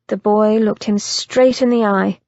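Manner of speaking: ordinary delivery, nothing marked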